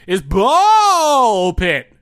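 slow, loud, drawn-out announcer boom. Shown as a demonstration. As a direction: announce it like a game-show host. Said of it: "Oh, I'm supposed to say".

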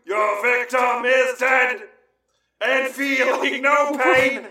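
high, sustained industrial-metal chant; hammed-up monotone rock yell.

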